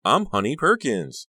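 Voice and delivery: high, slow, emphatic and loud; excited introduction. A narrator's reading. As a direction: announce yourself with girlish glee. Honey introduces herself, still gushing.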